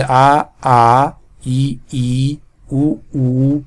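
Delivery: each vowel stretched long, आ आ ई ई ऊ ऊ; drawn-out vowels. Shown as a demonstration. Teacher drawing out आ ई ऊ for students. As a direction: hold every vowel long like a recitation teacher. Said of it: "खींच के पढ़ेंगे".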